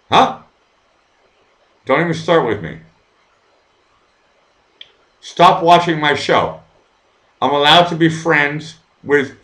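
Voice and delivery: loud, heated telling-off of viewers; furious and fed up, laying down the law; angry rant. Real, not acted. Streamer to viewers who mock his Russian friend, angry shouting.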